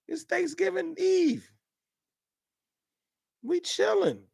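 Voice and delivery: a chill holiday-eve sing-song; high put-on voice. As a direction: pitch it way up and drag it out lazily. Answers chat in put-on voice.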